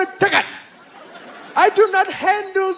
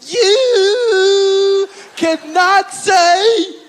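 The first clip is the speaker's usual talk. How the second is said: slow scolding; slower than usual. Retold or imitated. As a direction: stretch every word of the scold. Cosby scolding, slow and loud.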